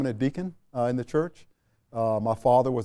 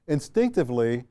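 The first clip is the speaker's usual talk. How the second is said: normal talking voice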